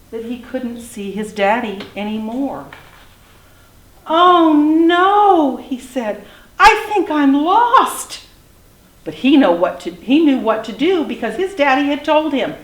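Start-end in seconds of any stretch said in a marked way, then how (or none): 4.1-5.6 high, loud, drawn-out cry of dismay; alarmed little dinosaur. A narrator's reading. Little dinosaur realizes he has lost Daddy, alarmed. 6.6-8.2 high, loud, slow storybook wail; lost little dinosaur. A narrator's reading. Little dinosaur alone in tall trees, dismayed wail.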